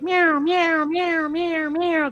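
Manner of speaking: high, insistent mock meows; high cat meows. Owner imitating her cat begging at feeding time, high insistent meows. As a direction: mimic a hungry cat begging. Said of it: "And he's like".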